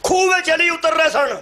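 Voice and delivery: high-pitched sermon narration; impassioned preaching. Real, not acted. Preacher telling of Ali descending the well, impassioned. Preaches a miracle story to crowd.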